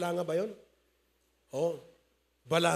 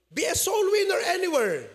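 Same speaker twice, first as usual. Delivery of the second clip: rousing call; 7 semitones higher, 3 dB louder than usual. Acted. Preacher exhorts congregation.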